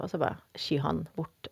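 ordinary delivery

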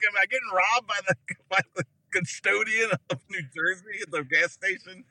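high, baffled questioning; incredulous mock alarm. Driver fearing custodian will rob him, mock alarm. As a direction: act out comic disbelief. Mock fear of being robbed.

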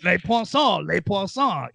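hammy cartoon French chef voice; sing-song mock French accent; bad French accent. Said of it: "ridiculous French accent".

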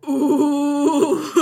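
a loud, high mock-nervous ooh; loud laughing ooh. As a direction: squeal it like two nervous girls waking up. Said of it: "both of us were like".

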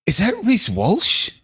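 put-on high, drawn-out excited kid voice; imitating awestruck son. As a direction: gasp the question like a starstruck boy. Voicing his starstruck young son.

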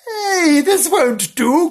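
high, loud, slow hey; cry out. Acted. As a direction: scold the scamps. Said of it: "cried Gillian".

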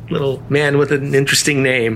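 ordinary delivery

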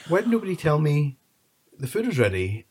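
retold indignant complaint. Child to family eating without them, acted indignation. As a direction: act out being left out, affronted.

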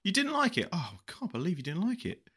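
very high, shocked question; mock shock. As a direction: gasp it in fake outrage.

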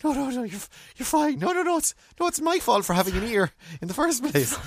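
very high, rushed no-no-no; frantic high reassurance. Retold or imitated. Bleeding customer reassuring the tearful barber, very high. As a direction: squeak out that he's fine. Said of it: "I'm like".

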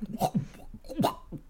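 high airless rasping croak, like a mouth full of earth; a breathy earthy gurgle from under the ground; cloddy soil voice. Retold or imitated. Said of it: "speaks in a kind of cloddy soil voice".

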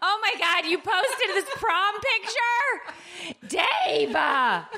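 high, teary wail through laughter; laugh-crying in touched protest. Real, not acted. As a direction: squeal it out, voice soaring with tears. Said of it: "she's crying".

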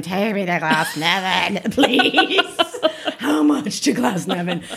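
drawn-out, playful plea with laughter; lightly hammed-up late-night pleading; mock tipsy taxi request. Tipsy reveller asking a ride to Glasnevin, mock pleading. Acting out herself after drinking.

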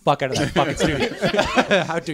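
loud, high, hammed-up abuse; joking rant breaking into laughter; mock diva tirade. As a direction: play an outraged host, laughing through it. Joke tirade at celebrity guest.

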